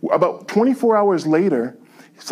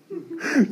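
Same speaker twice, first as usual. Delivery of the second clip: rueful laugh; 11 semitones higher, 5 dB quieter than usual. Real, not acted. Laughs at giving the cash away.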